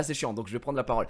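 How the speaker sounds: ordinary talk, nothing marked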